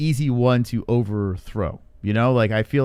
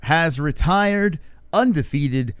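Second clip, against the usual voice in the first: announcer read; 4 semitones higher, much slower than usual. Acted. Show opener announcement.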